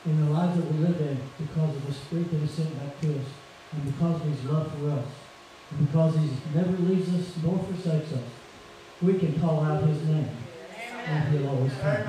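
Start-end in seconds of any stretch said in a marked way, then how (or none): none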